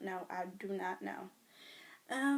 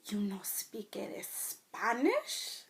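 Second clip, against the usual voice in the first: put-on Spanish accent; much slower than usual. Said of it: "they'll always be like".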